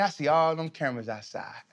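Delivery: usual speaking voice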